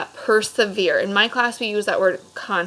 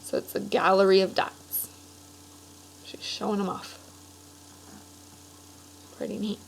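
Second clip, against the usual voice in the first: quiet commentary over the pictures; soft aside; 7 dB quieter than usual. Real, not acted. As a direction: drop to a murmur while pointing at the page. Aside while showing pictures.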